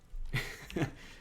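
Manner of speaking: short breathy giggle; tender airy laugh; soft giggle. Real, not acted. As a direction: chuckle fondly. Laughs at sleeping kitty.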